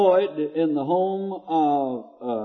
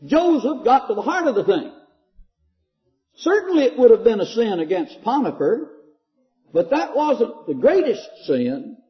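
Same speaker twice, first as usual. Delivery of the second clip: raised, louder pulpit voice; full-force preaching; 5 semitones higher, 4 dB louder than usual. Acted. Preacher pressing the sermon's point, raised and forceful. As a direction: drive the point home to the congregation.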